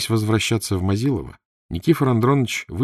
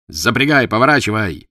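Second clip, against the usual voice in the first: loud, raised-pitch command; shouted order; 4 semitones higher, 8 dB louder than usual. A narrator's reading. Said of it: "закричал Евпатьев кучеру". (громкий крик кучеру)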